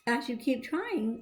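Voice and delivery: ordinary delivery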